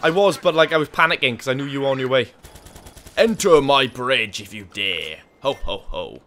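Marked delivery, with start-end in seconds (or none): 3.2-6.2 villain taunt. Pretend. Taunting villain quote while gaming.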